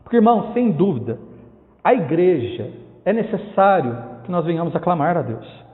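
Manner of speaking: normal talking voice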